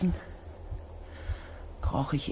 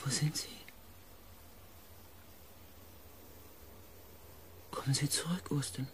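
hushed plea; 12 dB quieter, faster than usual. Acted. Narrator begging the missing companion to return, hushed plea. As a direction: plead quietly into the dark.

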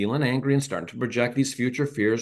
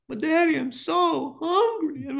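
put-on much higher, slow child's whine close to crying; young daughter whining to daddy before dinner, high and tearful; whiny little girl. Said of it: "not giving in to the whining".

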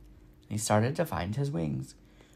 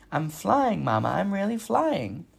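excited gosling; 7 semitones higher, 4 dB louder than usual. A narrator's reading. Gosling flying, calls mother.

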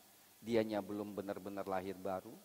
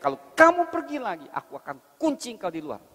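much higher and louder threat; threatening husband; 13 semitones higher, 15 dB louder than usual. Acted. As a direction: shout the lockout threat. Husband threatens to lock her out.

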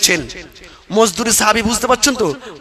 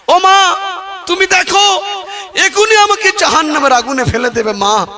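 pleading cry; 10 semitones higher than usual. Acted. Son begs mother on judgment day.

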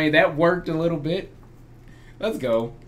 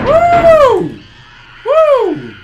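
high triumphant whoop; 14 dB louder than usual. Real, not acted. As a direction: cheer at the top of your voice. Escaped the monster.

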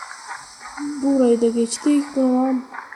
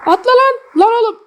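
urgent yell; 10 semitones higher, 7 dB louder than usual. Real, not acted. Yelling at character to jump.

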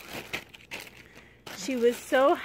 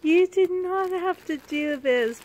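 high, loud grateful outburst; overwhelmed thanks, louder and higher; excited; 6 semitones higher, 8 dB louder than usual. Real, not acted. Vlogger telling Ashley she shouldn't have, excited.

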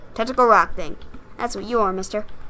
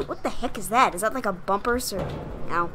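normal talking voice

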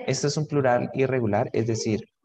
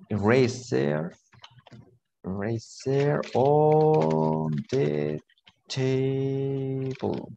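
very slow, words drawn out; drawn-out teacher dictation; 2 semitones higher, much slower than usual. Shown as a demonstration. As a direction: stretch each word as if writing it. Teacher spells out sentence while writing.

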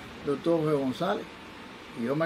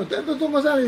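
high, raised-voice question; imitated shouting; 8 semitones higher, 3 dB louder than usual. Drunk stranger asking the doctor his name, imitated shout. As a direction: call out the question, lightly mock-shouted. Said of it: "cuando te gritan".

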